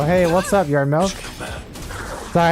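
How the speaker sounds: usual speaking voice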